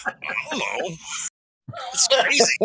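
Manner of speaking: high-pitched mock voice of a clueless player; Linda impression. Player teases Linda over the card, high mock voice. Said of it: "Linda's like".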